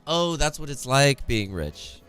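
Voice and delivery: ordinary talk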